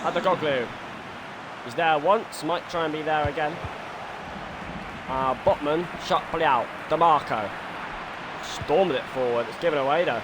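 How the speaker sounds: live football commentator voice; excited stadium commentary; play-by-play commentary. Acted. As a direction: call the match like a TV pundit.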